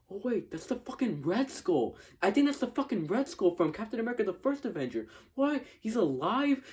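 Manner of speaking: high, fast, stunned fan outburst; shocked disbelief. Retold or imitated. Fan reliving spotting the Red Skull on screen, shocked and high-pitched. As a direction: squeal it like you can't believe who just showed up. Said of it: "and then I was like".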